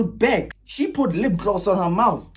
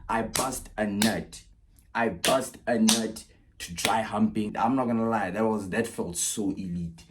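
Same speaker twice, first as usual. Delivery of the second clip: deep spent voice; 9 semitones lower, 5 dB quieter than usual. Acted. Post-climax deep confession.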